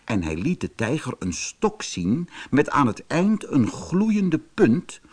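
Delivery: ordinary delivery, nothing marked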